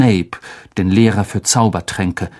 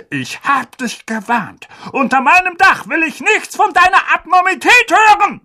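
higher, slowed raging uncle. Acted. Uncle raging at Harry across the table, higher. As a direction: rant with spit flying.

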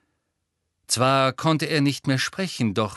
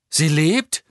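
louder, rising, can't believe it; ask, daring to hope; hopeful disbelief; 4 semitones higher, 7 dB louder than usual. Acted. Harry hears Hermione lives.

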